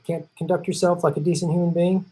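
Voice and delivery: normal talking voice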